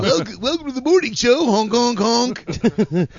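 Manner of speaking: goofy morning show host; high, loud clowning DJ; silly clown. Pretend.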